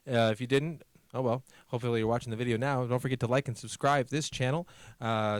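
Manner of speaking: ordinary talk, nothing marked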